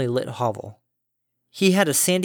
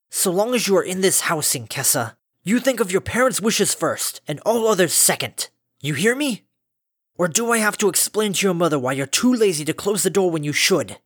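stern, raised, higher voice; angry scolding; 6 semitones higher than usual. Acted. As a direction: lecture her sharply. Father scolds daughter.

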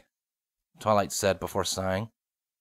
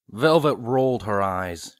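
usual speaking voice

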